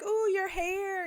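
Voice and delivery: slow, high admiring coo; lightly voiced swooning fan; gushing. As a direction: imitate a friend fawning. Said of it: "Carl was like".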